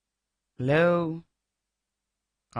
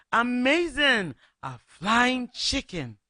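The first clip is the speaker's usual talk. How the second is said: amazed sparrows; 7 semitones higher, slower than usual. Acted. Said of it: "The sparrows and the swallows said".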